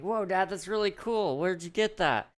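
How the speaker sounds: put-on high, amazed little boy; wide-eyed son marvelling at the toy; kid voice. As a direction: gasp like an excited kid.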